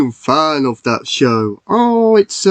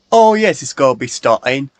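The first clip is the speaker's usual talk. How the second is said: ordinary delivery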